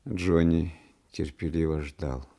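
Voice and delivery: ordinary delivery, nothing marked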